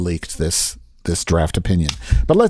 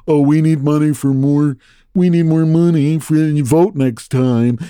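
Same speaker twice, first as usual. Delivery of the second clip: mocking Democrats; 3 semitones higher than usual. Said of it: "they're just saying".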